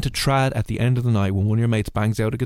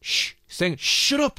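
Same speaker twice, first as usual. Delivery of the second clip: high hushing pub crowd, then a snapped shut up; hushing the room so Mickey can sing; mock shushing crowd; 14 semitones higher, much slower than usual.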